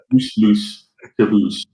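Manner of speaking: put-on Geordie accent. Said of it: "make it sound funny in a Geordie accent".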